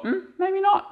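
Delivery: a doubtful hum, voice jumping high; high thinking voice. Retold or imitated.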